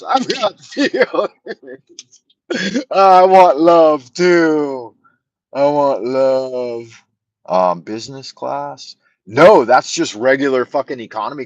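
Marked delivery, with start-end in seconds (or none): 0.0-2.2 laughing mock pathos. Mock self-pity. 2.9-7.0 high, slow, loud mock whimper; mock whiny plea. To the chat, a hammed-up slow whine for love.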